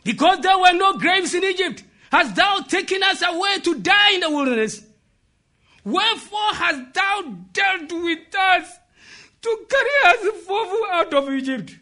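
hammed-up wail of reproach; acted complaining cry. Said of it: "look at the cry they were crying".

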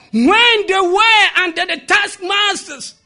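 loud, high sermon delivery; full-force preacher; impassioned preaching. Real, not acted. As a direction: drive it home to the congregation, high and forceful.

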